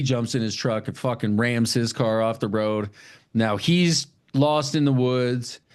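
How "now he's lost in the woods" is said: ordinary talk, nothing marked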